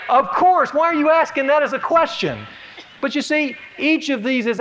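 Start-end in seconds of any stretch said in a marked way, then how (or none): 0.1-2.5 high, loud, amazed "of course"; incredulous of course. Pretend. Congregation answering an obvious question, incredulous. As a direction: scoff at a silly question. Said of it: "You would say".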